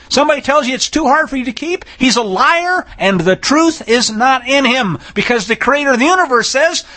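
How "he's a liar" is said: ordinary delivery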